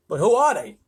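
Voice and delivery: baffled astronaut's cry. A narrator's reading. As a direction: cry out for an answer. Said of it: "cried Shanks".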